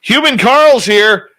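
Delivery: excited announcement. Real, not acted. Host hailing a chat regular, high and loud. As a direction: announce him like a star arriving.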